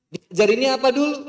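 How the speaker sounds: high, loud rising question; loud challenge. Real, not acted. Preacher quizzing the congregation, loud rising question. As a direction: fire it at the audience.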